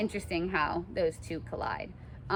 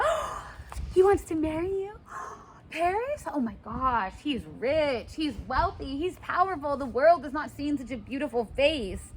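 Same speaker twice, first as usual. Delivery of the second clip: much higher, squealing excitement; thrilled gossip; 7 semitones higher than usual. Retold or imitated. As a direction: gasp and gush about rich Paris.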